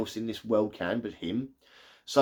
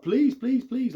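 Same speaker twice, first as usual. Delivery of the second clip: much higher, desperate mock begging; mock pleading; 11 semitones higher, slower than usual. Mother begging the werewolf with shotgun, high mock pleading. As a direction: beg for your life, half-jokingly. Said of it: "pleading with him".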